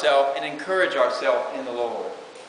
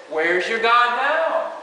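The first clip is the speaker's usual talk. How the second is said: slow, mocking taunt, pitched up; 5 semitones higher, much slower than usual. The enemy mocks the believer in trouble, slow and sneering. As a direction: drag it out like a jeer. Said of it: "It's a taunting thing".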